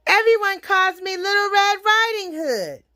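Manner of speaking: put-on high, sing-out little-girl voice. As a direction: pitch it up as an eager child. Little girl introduces herself.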